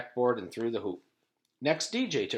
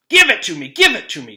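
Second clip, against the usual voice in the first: loud, high, greedy demand; 5 semitones higher, 15 dB louder, faster than usual. A narrator's reading. Bully Terrence demanding the promised thing from Louis. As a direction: insist like a spoiled kid. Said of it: "Terrence demanded".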